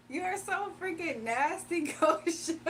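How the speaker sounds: laughing at Goshen's gross joke, can barely finish. Real, not acted. To the chatter Goshen, grossed out, laughing hard.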